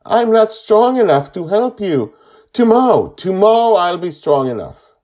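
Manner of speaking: put-on high, loud, wailing little boy; crying as he speaks. A narrator's reading. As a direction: plead tearfully at full voice. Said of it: "he cries as he says".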